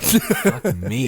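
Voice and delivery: ordinary talk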